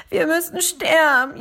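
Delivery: high, loud lament of doom; wailing frog. Acted. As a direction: wail it like a drowning frog giving up. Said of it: "jammerte er".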